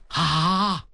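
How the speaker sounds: low, drawn-out astonished "ah"; shocked gasp. A narrator's reading. Crowd hears the enemy army is near, shocked exclamation. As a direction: let out a stunned, lengthened "ah". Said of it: "众人闻听大吃一惊啊".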